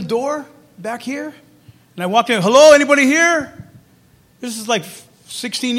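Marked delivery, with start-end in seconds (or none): 2.4-3.5 called out louder and higher into an empty hall; calling out. Retold or imitated. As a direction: call out to see if anyone is there.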